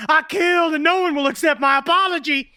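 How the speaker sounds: high-pitched hammed-up wail; overwrought mock confession. Acted overwrought confession by [name].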